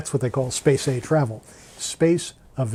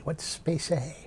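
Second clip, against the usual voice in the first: slow, hushed, wide-eyed ooh; intrigued listener voice; 3 semitones higher, 8 dB quieter, slower than usual. Pretend. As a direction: play a curious listener, drawn out and soft. Curious listener asks the question.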